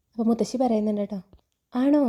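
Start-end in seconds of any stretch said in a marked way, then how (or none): 1.7-2.0 a quick, higher "really?" of surprise; grandma lightly surprised, pitch jumping up. Acted. Grandmother reacts to Aleena's new saree, mildly surprised.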